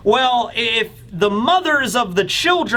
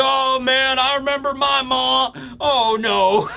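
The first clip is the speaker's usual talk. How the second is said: mock dread; 5 semitones higher than usual. Giants dreading their mothers.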